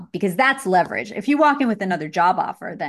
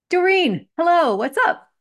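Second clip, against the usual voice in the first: much higher, louder hello; excited greeting; 9 semitones higher, 5 dB louder than usual. Real, not acted. Coach greets the next client on the call, excited. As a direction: call out brightly to welcome her.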